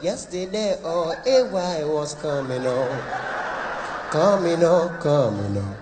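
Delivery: low note. Retold or imitated. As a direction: sing low like the husband.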